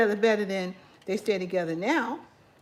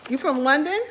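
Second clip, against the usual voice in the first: louder, higher, rising at the end; surprised question; 4 semitones higher, 8 dB louder than usual. Real, not acted. Asking a viewer about their home town, surprised. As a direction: echo it back as a surprised question. Asks back after reading the statement.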